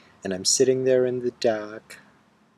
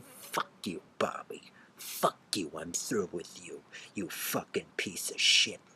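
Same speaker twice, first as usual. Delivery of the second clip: seething low curse; 5 semitones lower, 7 dB quieter than usual. Acted. Telling Bobby off.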